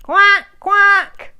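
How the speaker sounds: silly duck voice; duck quacking. A narrator's reading. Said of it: "says the duck".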